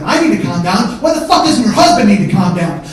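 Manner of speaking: loud, outraged retort; indignant comeback. Retold or imitated. Mike answering his brother's wife, indignant and loud. Mike angrily throws her words back.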